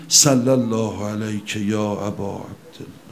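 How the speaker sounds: low, soft salutation said under the breath; hushed devotional greeting. Shown as a demonstration. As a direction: whisper it to the Imam with longing. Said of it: "صبح وسط کار بگی". (سلامی آرام و عاشقانه با صدای پایین)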